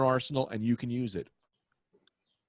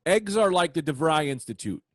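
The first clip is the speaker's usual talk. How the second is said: ordinary delivery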